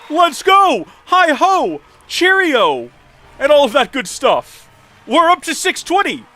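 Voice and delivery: high, loud victory cheer; excited cheering. Real, not acted. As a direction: whoop it up after winning. Celebrating the win.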